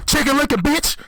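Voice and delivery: high, loud gamer trash talk; sudden shrieked taunt; taunting enemy yell. Acted. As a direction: scream it like a trash-talker in the lobby. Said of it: "They're just like".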